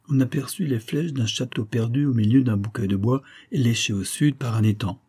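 plain reading voice

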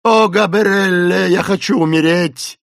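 loud, high drawn-out wail; wretched cry. A narrator's reading. Unhappy Esmeralda squeezing her eyes shut, crying out. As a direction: wail slowly in despair. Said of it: "закричала несчастная женщина".

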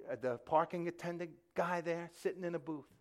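normal talking voice

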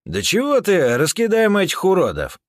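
high, loud cocky retort; brash loud retort. Acted. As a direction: boast loudly over the argument. Heated argument over plan. (громкая бравада)

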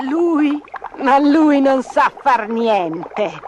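slow, drawn-out mocking sneer; scornful old peddler woman. A narrator's reading. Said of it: "sghigna vecchia venditrice ambulante".

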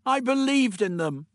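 high, anguished protest; despairing cry. Acted. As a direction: cry out as one let down. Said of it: "cried in despair".

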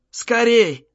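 high-pitched shout to hurry; father urgently shouting at his son. A narrator's reading. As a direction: cry «Скорей» sharply upward. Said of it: "крикнул".

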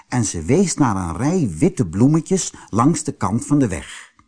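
ordinary delivery, nothing marked

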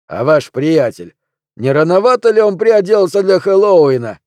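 rising high and loud; jeering question. A narrator's reading. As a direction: needle him about his friend, voice climbing. Barman jeers at the costumed man.

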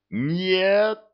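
beaming. A narrator's reading. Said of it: "сияя радостной улыбкой".

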